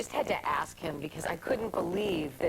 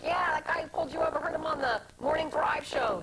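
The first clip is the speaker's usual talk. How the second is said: a lightly voiced excited crew member, pitched higher; 11 semitones higher than usual. Guest imitates the catering crew sharing the news, excited and high. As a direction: relay the gossip like a thrilled crew guy, pitched up. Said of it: "guys from catering and Honda are like".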